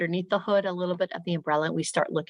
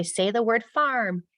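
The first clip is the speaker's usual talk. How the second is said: high-pitched classroom drill voice; teacher's lifted, deliberate prompting; teacher to class; 6 semitones higher, slower than usual. Shown as a demonstration. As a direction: lead young pupils through a sound drill. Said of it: "I might say".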